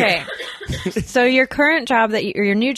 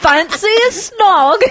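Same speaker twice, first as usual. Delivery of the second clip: mock British accent; 8 semitones higher, 6 dB louder, slower than usual. Joking British accent bit.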